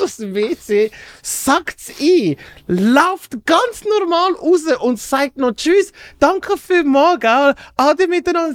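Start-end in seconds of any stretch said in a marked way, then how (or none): 5.5-8.5 a light put-on innocent goodbye; mock innocent goodbye. Retold thief saying goodbye innocently.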